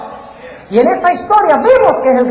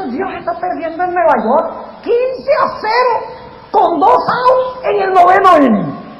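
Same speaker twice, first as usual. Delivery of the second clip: mock despairing fan; 6 semitones higher than usual. Preacher mocking people who think God is losing. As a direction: ham up the fan who has given up on God.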